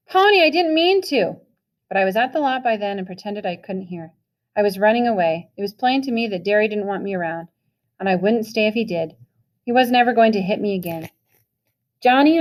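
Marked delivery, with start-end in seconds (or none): 0.1-1.4 high, loud desperate call; scream. A narrator's reading. Brother screaming after Pony as he runs off, desperate. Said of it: "Derry screamed". 12.0-12.4 high, loud call across the lot. A narrator's reading. Pony calling Johnny awake in the dark lot, calling out. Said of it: "I called".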